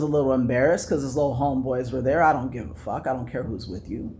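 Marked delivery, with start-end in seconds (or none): none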